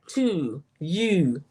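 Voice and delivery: "to" and "you" drawn toward a long "ooh"; regional O demo. Shown as a demonstration. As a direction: show the "ooh" vowel in "to" and "you". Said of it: "the O's are like the ooh".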